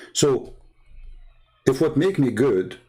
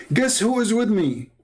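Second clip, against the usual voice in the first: high-pitched imitation of a thrilled husband; animated, high mock excitement; excited retold question; 11 semitones higher than usual. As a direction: burst out with big news for your wife. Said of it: "Why you are excited".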